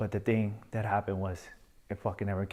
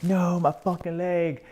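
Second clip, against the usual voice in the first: high, loud and slow desperate cry; pained shout, much higher than his usual voice; anguished cry; 9 semitones higher, 5 dB louder, slower than usual. Retold or imitated. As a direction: cry out as your leg falls away. Leg rolls off the stretcher.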